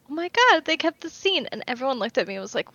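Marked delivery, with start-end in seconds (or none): 0.1-1.5 high-pitched excited realization; retold gasp of recognition, pitched up; excited reaction. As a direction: react like a fan spotting a favorite scene. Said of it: "I was like".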